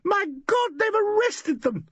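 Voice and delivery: high, slow hysterical cry; a young woman in panic at an arrest; hysterical scream. A narrator's reading. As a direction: shriek it in panic. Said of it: "screamed Valerie Gaines".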